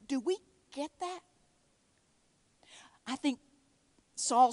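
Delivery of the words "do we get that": usual speaking voice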